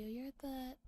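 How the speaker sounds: ordinary talk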